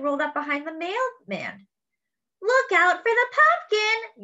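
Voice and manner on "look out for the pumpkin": high, loud warning call; girl yelling a warning to the mail carrier; yelled warning. Acted. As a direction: shout to the mailman to get clear. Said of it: "yelled Cassie".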